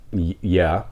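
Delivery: stammered, quiet unsure yeah; flabbergasted. Retold or imitated. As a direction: trail off, thrown and hesitant. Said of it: "I'm flabbergasted".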